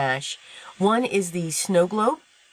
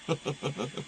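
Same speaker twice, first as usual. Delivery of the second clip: soft laugh; 10 dB quieter than usual. Real, not acted. Laughs at own forgetfulness.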